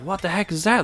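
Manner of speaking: high-pitched baffled question; acted bewilderment. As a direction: play a startled crowd, voice shooting up. Crowd stares at the coffin.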